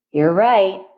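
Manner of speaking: usual speaking voice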